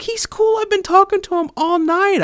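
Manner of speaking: high-pitched, insistent vouching; retold squeaky defence of a friend; earnest defending. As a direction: stick up for him, voice way up. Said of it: "defending him to my core".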